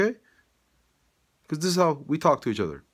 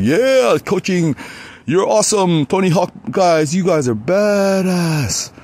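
high, loud hyped-up praise; mock cheering; 7 semitones higher, 10 dB louder, slower than usual. Cheering skaters while riding through, high and loud. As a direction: cheer them on like a hype coach.

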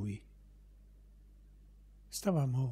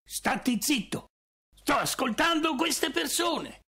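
much higher, building into a shout; irritated shout; 11 semitones higher than usual. Acted. Son silencing his father over the guests, rising to a shout. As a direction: snap at him, then raise it to a yell.

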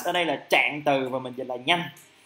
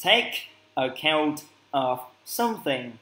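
slow model phrase; slower than usual. Shown as a demonstration. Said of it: "mình cũng dịch là cân nhắc".

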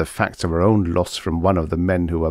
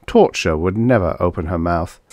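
ordinary delivery

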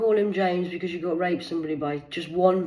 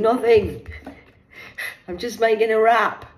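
defensive; 7 semitones higher, 8 dB louder than usual. Retold or imitated. Mum's defensive reply, loud.

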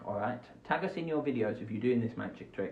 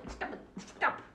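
softer unvoiced hush to the puppet; whispered scolding; 9 semitones higher, 6 dB quieter than usual. Host quietly telling the rat puppet to stop it. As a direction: hiss it under your breath.

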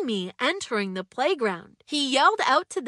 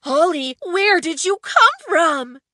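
higher, louder startled question; startled friend, caught in the act and crying out; 5 semitones higher, 8 dB louder than usual. Acted. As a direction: jump out of your skin and call out. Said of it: "he cried in surprise".

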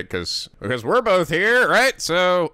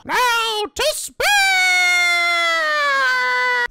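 frantic screaming; 3 dB louder, slower than usual. Acted.

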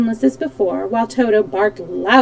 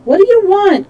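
high, loud called question; raised voice, pitched well up; calling out; 11 semitones higher, 7 dB louder than usual. A narrator's reading. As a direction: call across to a hostile group. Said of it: "called the Shaggy Man".

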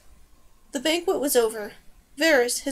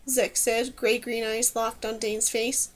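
usual speaking voice

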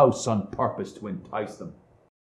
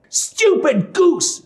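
high, slow witch insult; scolding witch; 3 dB louder, slower than usual. A narrator's reading. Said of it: "said the old witch".

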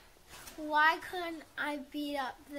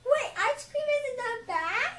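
ordinary talk

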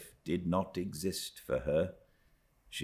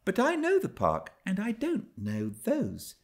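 put-on young Cathy to herself. A narrator's reading. Cathy murmuring to herself about the hills, high wistful voice. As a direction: muse aloud like a longing little girl. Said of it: "she murmured to herself".

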